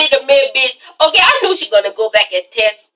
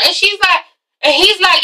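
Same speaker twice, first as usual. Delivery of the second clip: ordinary delivery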